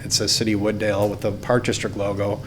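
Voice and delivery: ordinary talk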